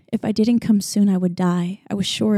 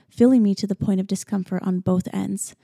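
ordinary delivery, nothing marked

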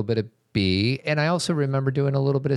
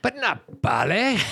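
higher, slow gruff English dad; northern English accent; 13 semitones higher, slower than usual. Retold or imitated. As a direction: drag out ballet like Billy Elliot's dad. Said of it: "You do that well".

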